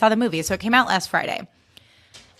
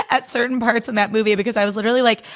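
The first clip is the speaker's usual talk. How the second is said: normal talking voice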